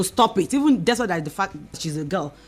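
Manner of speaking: usual speaking voice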